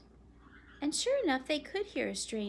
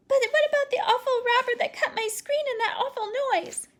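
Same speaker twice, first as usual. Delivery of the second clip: put-on high, loud, anxious child's voice; a scared girl pleading with her father; frightened little girl; 10 dB louder than usual. Phoebe still frightened.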